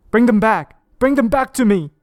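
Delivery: high, loud pleading shout; desperate yell. Acted. Detective begging the game for his family, loud and high. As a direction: scream at the game to return them.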